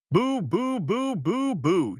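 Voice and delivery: very high mock booing; mocking boos. A narrator's reading. Mother mocking her daughter's self-pity with high boos. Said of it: "Judy shouted".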